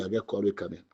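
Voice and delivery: usual speaking voice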